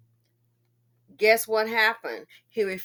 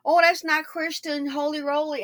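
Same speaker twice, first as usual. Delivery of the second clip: lightly voiced, higher sanctimonious scold; a hint of a prim church lady tutting; mock churchy scolding; 6 semitones higher than usual. Mocking people who call her unforgiving, mild sanctimonious tone. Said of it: "So people say".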